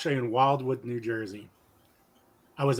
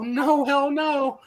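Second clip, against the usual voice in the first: higher, slow drawn-out yell of no; shouted refusal; 13 semitones higher, much slower than usual. Retold or imitated. Refusing the drugged guy, slow defiant shout. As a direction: plant your feet and yell no.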